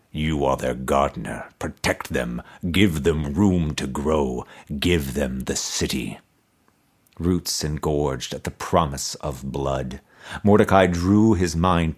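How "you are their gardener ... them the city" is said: resonant toneless skull. A narrator's reading. Buried emperor's skull answering Mordecai, low resonant toneless voice. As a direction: intone it without any tune, deep in the earth. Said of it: "answered in a resonant voice, toneless".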